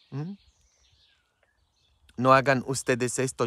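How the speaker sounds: ordinary delivery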